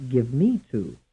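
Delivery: "me" stressed in "give me to"; stress on second syllable. Shown as a demonstration. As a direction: lean on "me" in the middle. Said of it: "stressed on the second syllable".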